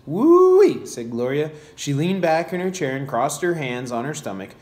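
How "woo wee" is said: high, loud whoop of amazement; big whooped reaction in a story reading; amazed exclamation. A narrator's reading. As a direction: draw out an impressed, high-pitched holler. Reacts to book's huge page count.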